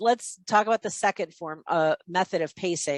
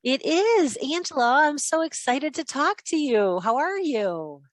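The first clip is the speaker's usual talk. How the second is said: higher pitch, mirroring the caller; a higher, eager sales voice; matching high voice; 5 semitones higher, slower than usual. Shown as a demonstration. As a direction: lift your voice up to match hers. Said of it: "the last one with the high voice".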